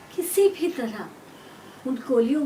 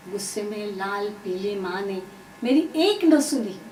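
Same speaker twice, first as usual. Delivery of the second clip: ordinary delivery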